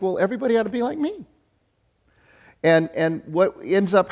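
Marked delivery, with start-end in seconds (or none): 0.0-1.2 smug thought. Retold or imitated. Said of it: "we see ourselves and we think".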